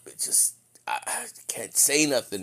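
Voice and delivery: voiceless husky whisper. Acted. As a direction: whisper like your throat is parched. Acting out a dry, voiceless throat.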